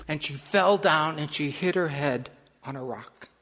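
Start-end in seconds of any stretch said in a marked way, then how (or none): none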